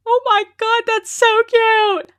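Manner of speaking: high, loud squeal of delight; gushing. Real, not acted. Streamer reacts to a very cute dog, high delighted squeal. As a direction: gush loudly, stretching the last words.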